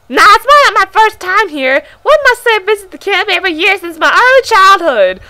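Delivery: strained high crow voice; crow-like pioneer voice. Acted. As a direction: make him sound like a crow. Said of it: "making him sound like a crow".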